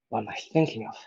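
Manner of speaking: hushed self-questioning. Acted. Misread line, then corrected.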